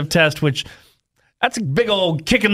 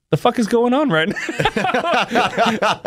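laughing disbelief; 5 semitones higher, much slower than usual. Retold or imitated. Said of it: "I'm like".

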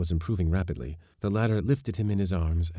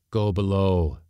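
a louder stern command; sternly; 2 semitones higher, 6 dB louder than usual. A narrator's reading. Skipper orders the mate below, stern and louder. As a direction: send him off with no warmth. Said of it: "said the skipper sternly".

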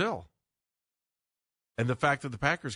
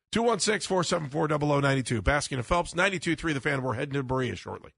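loud, high on-air phone plug; radio announcer; 4 semitones higher, 4 dB louder than usual. Acted. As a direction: sell the call-in number. Station phone number plug.

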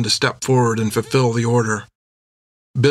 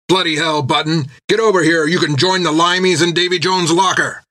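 yell; 6 semitones higher than usual. A narrator's reading. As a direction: bellow across the battle. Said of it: "Jack yelled".

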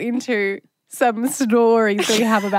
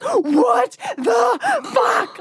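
shocked; 8 semitones higher, much slower than usual. Retold or imitated. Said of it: "he's like".

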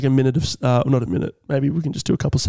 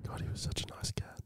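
hushed whisper during a silence; barely audible mock mourning; mock-solemn whisper; 21 dB quieter than usual. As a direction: whisper a tribute under your breath.

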